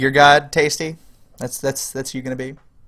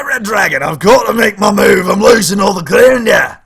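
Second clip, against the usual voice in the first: shouting; 8 dB louder than usual. Real, not acted. Panicking over losing ground.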